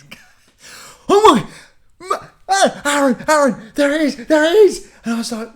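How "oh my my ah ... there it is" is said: high, loud, thrilled gasping cries; imitating mum pointing out a ghost orb, high and loud; excited mum seeing an orb. Mum calling to Aaron about the orb, high and loud excitement.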